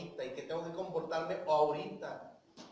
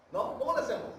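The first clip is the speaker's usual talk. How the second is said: ordinary talk, nothing marked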